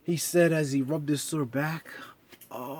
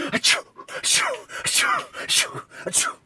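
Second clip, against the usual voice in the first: high breathy whispered sneezes. Acted. Reindeer sneezing, whispered and high. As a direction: sneeze in airy tiny whispers. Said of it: "another round of sneezes".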